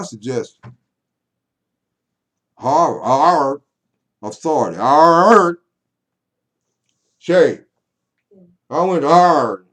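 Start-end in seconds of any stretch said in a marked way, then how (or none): 2.6-9.7 loud, high comic outburst; mock shouting. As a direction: bellow the drawn-out words for laughs. Mock outburst, comedian coming out.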